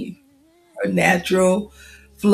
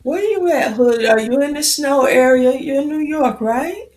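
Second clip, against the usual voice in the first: higher, lively calling out; eager question; 7 semitones higher, 4 dB louder than usual. Real, not acted. Host eagerly asks a viewer where they are, higher and lively.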